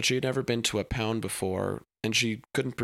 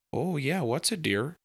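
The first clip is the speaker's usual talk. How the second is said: higher, swooping hammed-up Minnesota accent; sing-song dad with a comic Minnesota accent; Richard's absurd Minnesota accent; 6 semitones higher than usual. A narrator's reading. Shy dad answers his daughter, absurd Minnesota accent. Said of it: "said Richard in his absurd accent".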